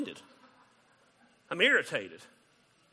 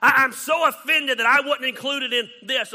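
much higher and louder, hammed-up outrage; mock offended outrage; 9 semitones higher, 11 dB louder than usual. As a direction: play the wounded, excluded friend to the hilt. Mocking offended person, even louder.

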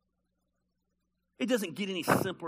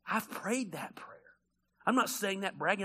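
usual speaking voice

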